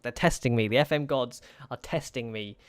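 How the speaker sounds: ordinary talk, nothing marked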